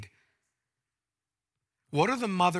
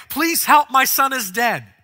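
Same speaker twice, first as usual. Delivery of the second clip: pleading; 8 semitones higher, 10 dB louder than usual. Acted. Imagined plea she does not make.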